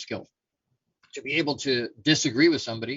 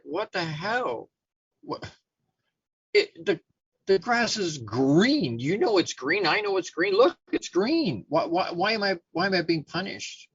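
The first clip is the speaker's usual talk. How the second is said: higher, drawn-out indignant tiger; incredulous protest; 6 semitones higher than usual. Acted. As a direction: plead the obvious in baffled exasperation. Punished tiger protests to the lion.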